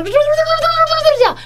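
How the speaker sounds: high, slow, woozy happy voice; giddy drunk act. Retold or imitated. Waking from anaesthesia, acting out her silly happy self to friends. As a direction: play the giddy patient just waking up.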